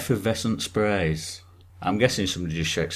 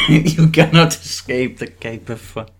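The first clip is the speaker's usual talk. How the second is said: laughing villain line; 4 semitones higher, 10 dB louder than usual. Pretend.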